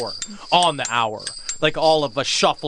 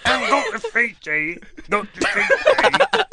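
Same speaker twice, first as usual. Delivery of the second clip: high Cockney street cry; Cockney vendor; 7 semitones higher than usual. Acted. Fishmonger crying wares on a London street, high Cockney. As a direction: call out like a fishmonger.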